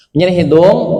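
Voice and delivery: normal talking voice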